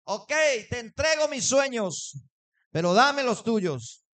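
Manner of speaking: loud, frustrated plea to God; angry surrender. Retold or imitated. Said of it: "en ese enojo agarré mi guitarra".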